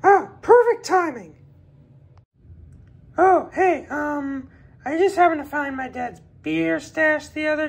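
high, eager little voice; a kid sweet-talking a friend into helping; pleading kid. Acted. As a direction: wheedle like a kid asking a favor. Kid begs for help, high voice.

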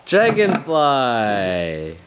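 slow, careful. Shown as a demonstration. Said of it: "toddler says Dragonfly, parent models".

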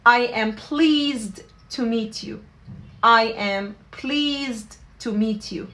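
slow, clearly spaced English phrase; slow teaching model. Shown as a demonstration. Teacher modelling the phrase slowly for students. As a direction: say it slowly so learners can repeat. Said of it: "فبنحكي".

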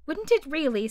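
high, astonished question; great surprise. Acted. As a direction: gasp it out in surprise. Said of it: "in a tone of great surprise".

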